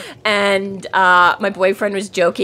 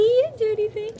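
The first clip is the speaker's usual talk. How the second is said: high, pitying protest; mock pity; 13 semitones higher, 5 dB quieter than usual. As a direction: defend the innocent puppy. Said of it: "the puppy defended".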